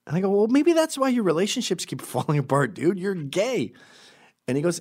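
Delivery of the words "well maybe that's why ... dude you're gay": high-pitched comic exasperation; mock exasperated. Man setting his friend straight, high and exasperated. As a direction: point out the obvious, imitating disbelief.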